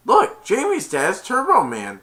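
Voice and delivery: high, loud excited announcement; amazed kid. Retold or imitated. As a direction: point out the hero like an amazed kid. Acting neighbor kid calling out.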